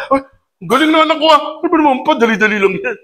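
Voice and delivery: put-on a parent who can't believe how fast the child was; amazed parent. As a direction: voice jumps up, impressed and amazed. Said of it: "parent amazed at child's skill".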